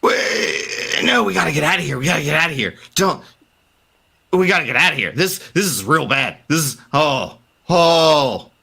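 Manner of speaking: a doctor warning about the church; frightened doctor. Acted. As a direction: stammer out the warning in fear. GM holds frightened NPC voice.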